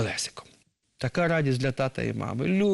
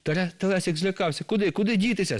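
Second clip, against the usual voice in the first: ordinary delivery, nothing marked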